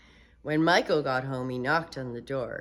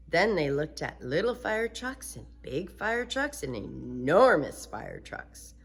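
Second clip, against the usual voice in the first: usual speaking voice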